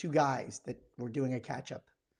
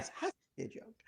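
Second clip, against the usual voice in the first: breathy whispered aside, disbelieving; whispered repeat; 10 dB quieter, much faster than usual. Retold or imitated. To a podcast cohost, recalling a rude diner: whispered disbelief. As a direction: say it again under your breath in disbelief.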